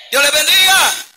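"Dios les bendiga" called out high and loud, "bendiga" stressed. Shown as a demonstration.

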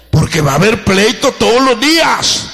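usual speaking voice

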